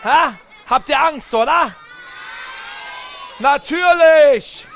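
loud, high mock-angry shout; angry look shown. Playful angry shout at the children to scare them. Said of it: "Ich zeig euch mal, wie ich böse gucken kann".

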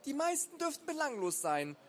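high-pitched little professor; lecturing boy. A narrator's reading. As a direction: lecture as a know-it-all kid. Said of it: "dozierte der Junge".

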